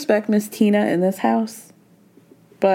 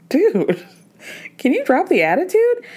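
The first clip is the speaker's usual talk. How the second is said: high, incredulous push-back; exasperated retort; 7 semitones higher than usual. Retold or imitated. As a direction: throw it back at her. Quoted retort in quarrel.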